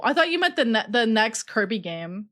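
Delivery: usual speaking voice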